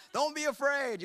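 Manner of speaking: high, loud, insistent advice; mock blunt advice. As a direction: blurt it as useless reassurance. Acting out useless advice.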